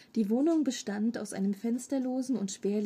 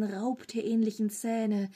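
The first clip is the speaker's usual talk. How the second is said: usual speaking voice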